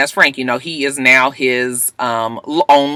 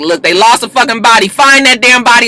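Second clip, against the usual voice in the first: forceful demand; 6 semitones higher, 5 dB louder than usual. Retold or imitated. Annalise demanding action.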